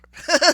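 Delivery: a sudden loud burst of laughter; short loud chuckle; amused laugh. Real, not acted. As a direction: laugh at your own joke. Laughs at own Spanish.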